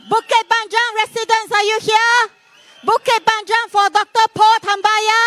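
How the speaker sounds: high-pitched rally roll call; calling out to crowd. Real, not acted. Speaker seeking Bukit Panjang residents, high calling voice. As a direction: pitch it up to reach the back.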